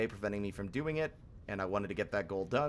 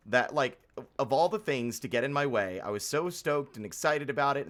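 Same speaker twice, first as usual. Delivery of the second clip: ordinary delivery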